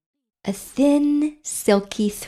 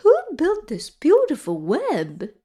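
high, swooping owl voice; storybook owl; 8 semitones higher than usual. A narrator's reading. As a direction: hoot the question like an owl. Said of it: "asked the owl".

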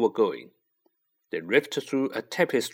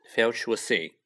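ordinary delivery

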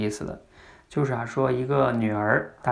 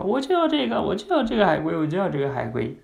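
whining daughter; 6 semitones higher than usual. Retold or imitated. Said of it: "就会说".

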